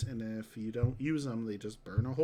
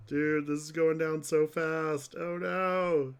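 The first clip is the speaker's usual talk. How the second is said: loud, slow, higher dismay; 5 semitones higher, 7 dB louder, slower than usual. Real, not acted. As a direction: groan at it draining away. Watching resources drain fast.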